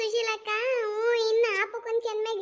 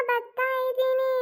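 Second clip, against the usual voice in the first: normal talking voice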